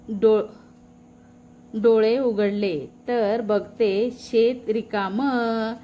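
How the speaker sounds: usual speaking voice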